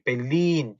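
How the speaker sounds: "벨린" with a long, stressed final 린; short first syllable, lean on the end of "벨린"; stress on second syllable. Shown as a demonstration. Teacher shows where stress falls, on the back of "벨린". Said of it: "뒤에 강세가 붙어요".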